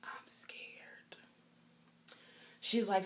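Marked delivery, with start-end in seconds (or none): 0.0-1.2 unvoiced frightened whisper; patient whispering her fear to the nurse; scared whisper. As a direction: whisper it, barely holding it together. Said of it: "But I was like".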